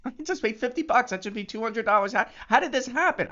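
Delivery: high and fast, bubbling over; giddy high exclamation with a laugh; excited squeal. Retold or imitated. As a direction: rattle it off in giddy disbelief. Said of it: "I get so excited".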